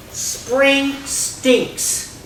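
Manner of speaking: defeated grumble. Acted. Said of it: "says Bruce".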